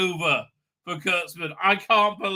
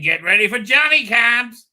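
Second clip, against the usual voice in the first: announcer quote; 6 semitones higher, 9 dB louder than usual. Retold or imitated. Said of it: "I remember saying".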